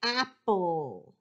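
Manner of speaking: "apple" drawn out as AP-pull; both syllables of "apple" stretched; dictated word. Shown as a demonstration. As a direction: say "apple" slowly, falling at the end. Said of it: "I want the word".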